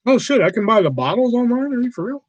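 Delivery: real surprise jumping high in pitch; sudden delighted disbelief; surprised. Real, not acted. Spotting bottles for sale online, a high, real surprised exclamation.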